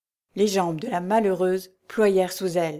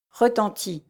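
usual speaking voice

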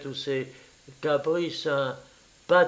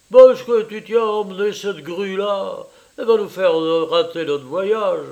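loud, higher, indignant objection; annoyed protest; 3 semitones higher, 6 dB louder than usual. A narrator's reading. Rejected friend objects to taking the woman along, annoyed. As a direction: argue crossly against the whole plan.

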